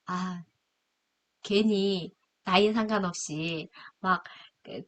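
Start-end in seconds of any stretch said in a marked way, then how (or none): none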